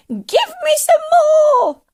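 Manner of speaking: slow, very high shriek; greedy old woman hollering; demanding cry. A narrator's reading. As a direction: screech the demand at full force. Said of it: "she cried".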